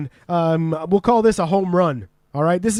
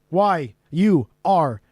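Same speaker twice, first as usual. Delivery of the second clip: normal talking voice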